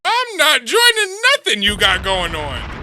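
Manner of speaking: loud, high, drawn-out shout; loud, slow, high-pitched refusal; indignant outburst. Real, not acted. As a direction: holler the refusal, stretching it out. Indignant comeback to the video's invitation.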